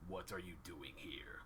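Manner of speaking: low, soft menacing half-orc voice; half-orc guard questions an intruder, low and soft; half-orc asking. Acted. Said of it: "he says".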